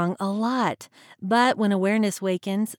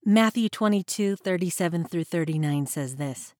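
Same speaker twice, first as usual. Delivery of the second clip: normal talking voice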